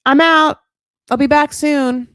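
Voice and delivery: loud, high mock announcement of a text; breezy text read-out. Man texting his partner he's out, loud and breezy. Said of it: "left her a text like".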